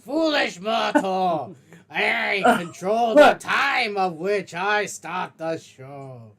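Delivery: slow booming villain shout; Aku. Acted. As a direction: roar it like an evil overlord. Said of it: "It is Aku".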